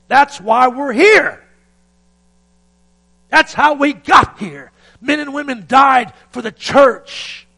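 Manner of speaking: loud, high, slow shouted sermon climax; thundering pulpit cry, words stretched slow; full-force preaching. Acted. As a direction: preach it out at the top of your voice.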